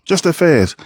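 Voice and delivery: ordinary talk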